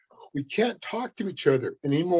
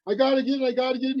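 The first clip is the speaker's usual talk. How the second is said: desperate Coyote; 7 semitones higher, much faster than usual. Acted.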